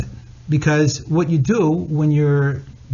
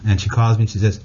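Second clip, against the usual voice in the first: ordinary talk, nothing marked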